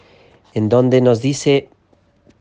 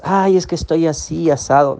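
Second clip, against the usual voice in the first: higher, lightly whiny mock complaint; 7 semitones higher than usual. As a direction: imitate someone moaning about how they feel. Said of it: "Cuando digas". (queja quejumbrosa en broma, en tono más agudo)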